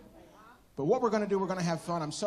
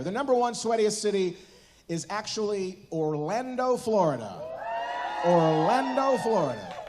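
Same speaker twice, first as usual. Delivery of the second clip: loud, raised announcement building to a shout; countdown reveal; 4 semitones higher, 6 dB louder than usual. Real, not acted. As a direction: build to the big reveal, then belt out the repeat. Host reveals top of countdown list.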